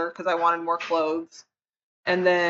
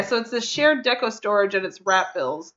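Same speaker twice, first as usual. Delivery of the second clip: usual speaking voice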